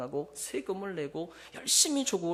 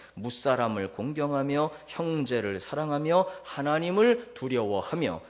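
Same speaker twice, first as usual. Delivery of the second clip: scripture reading; 6 dB louder than usual. Acted. Reads verse aloud.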